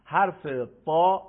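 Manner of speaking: حرف تا, with تا loud and heavy as emphatic ط; loud teacher demo of emphatic ta. Shown as a demonstration. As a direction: belt out تا with a full, thick ط. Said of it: "خیلی ساده و روان".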